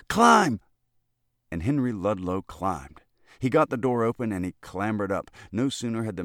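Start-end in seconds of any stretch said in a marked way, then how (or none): 0.1-0.6 high, loud urgent command; boy shouting at the lobbyist to climb. Acted. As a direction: bark the single word at him.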